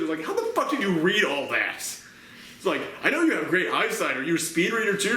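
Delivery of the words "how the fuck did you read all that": ordinary talk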